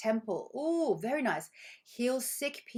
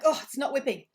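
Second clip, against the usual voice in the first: mock frustrated baker; 6 semitones higher, 6 dB louder than usual.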